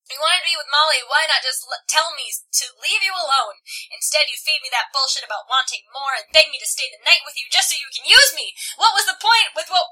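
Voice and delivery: angry rant building to a high shout; escalating, outraged tirade; acted scream. A narrator's reading. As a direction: start heated, climb to a loud shriek. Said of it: "I scream".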